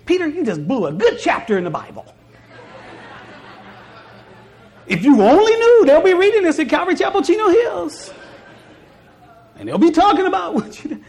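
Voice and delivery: laughing, high and loud playful teasing; amused Jesus. Retold or imitated. Jesus laughingly teases Peter after he sinks, high and loud. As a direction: chuckle at Peter as a fond friend would.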